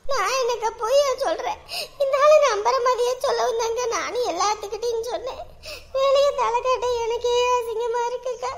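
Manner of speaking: tearful, helpless lament; crying. Acted. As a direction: pour out grief to your sister through tears. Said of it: "நீ அழுகாத". (அழுதுகொண்டே)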